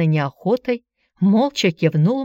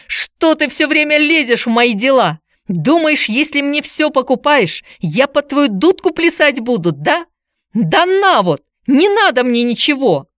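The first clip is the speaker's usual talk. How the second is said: high, loud tirade; enraged yelling; 8 semitones higher, 5 dB louder than usual. A narrator's reading. Boy lashes out at his meddling aunt, yelling. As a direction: yell in defiant fury. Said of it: "Антон кинулся к тётке и заорал".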